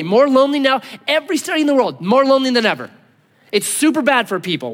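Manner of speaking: ordinary talk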